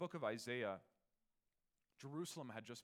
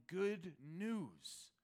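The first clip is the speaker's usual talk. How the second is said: higher, louder call of good news; mock messenger yell; 8 semitones higher, 8 dB louder, much slower than usual.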